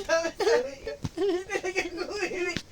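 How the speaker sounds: high, loud laughing speech; words wobbling with laughter. Real, not acted. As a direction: talk through giggles. Laughing while retelling.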